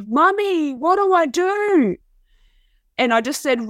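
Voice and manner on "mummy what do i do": high, pleading little boy's cry, imitated; panicked child's cry. Mother imitating her son screaming for help in the fire. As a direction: voice the son's panic through the wall. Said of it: "he screamed through the wall in panic".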